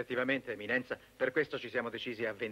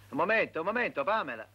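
higher, louder irritated reply; exasperated; 5 semitones higher, 5 dB louder than usual. Acted. Sandro holding off impatient Pamela, exasperated. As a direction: fend off the nagging loudly.

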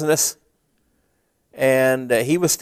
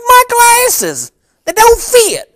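much higher, louder put-on voice of a grumpy customer; disguised complaining customer; 7 dB louder, slower than usual. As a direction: fake a whiny customer to tease an old friend. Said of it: "here's exactly how I said it".

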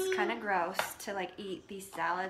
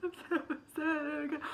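mock sad; 7 semitones higher, slower than usual. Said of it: "No, I'm kidding".

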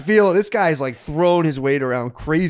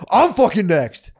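loud, high, drawn-out angry yell; retold spaz outburst; 6 semitones higher, 6 dB louder, slower than usual. Storyteller showing what he said instead, loud angry spaz. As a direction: burst out, stretching each word. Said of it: "spaz, full fucking spaz".

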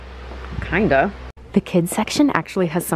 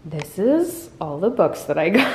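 ordinary talk

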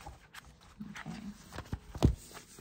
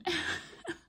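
light breathy giggle; quick airy laugh; 13 semitones higher than usual. Real, not acted. Laughs after advertising joke.

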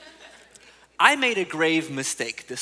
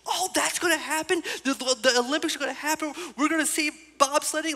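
higher, eager imitation of a thrilled girl; excited daughter; 9 semitones higher than usual. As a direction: play the daughter who can't believe it. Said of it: "she's just like".

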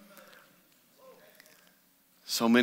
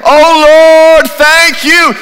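loud cry of thanks; 16 dB louder, much slower than usual. Acted. Acting grateful cry to God.

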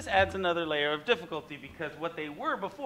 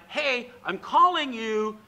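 slow, louder call; 6 semitones higher, 8 dB louder, slower than usual. Retold or imitated. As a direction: call across to someone. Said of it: "Trying to get someone to realize".

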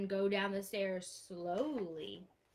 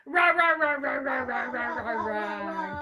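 dinosaur roar; 2 semitones higher, 9 dB louder than usual. Pretend. Teacher roaring like a dinosaur for the class, loud. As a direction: roar it out big for the kids. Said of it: "one little dinosaur says".

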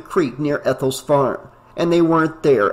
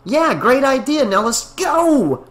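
higher, put-on eager voice; impatient Reggie; 10 semitones higher than usual. A narrator's reading. Reggie chimes in eager to leave, higher. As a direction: push to leave now. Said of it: "Reggie chimed in".

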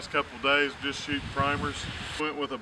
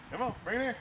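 high coaxing call; 8 semitones higher, 3 dB quieter, much faster than usual. Real, not acted. Calling puppy to bring bumper.

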